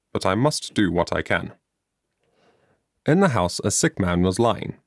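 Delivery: ordinary delivery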